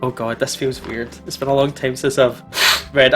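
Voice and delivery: usual speaking voice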